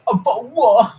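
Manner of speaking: high, loud, laughing British bottle of water; hammed-up British meme, cracking up; mock British accent. Streamer's last loud British meme take, laughing.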